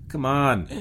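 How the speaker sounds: effortful, impatient command; grated urging. A narrator's reading. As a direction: grate it out while hauling. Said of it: "Jones grated".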